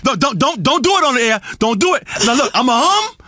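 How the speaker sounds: ordinary delivery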